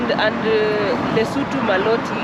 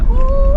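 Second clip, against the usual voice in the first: high delighted squeal; excited ooh; 9 dB louder than usual. Real, not acted. Excited cheer on the road.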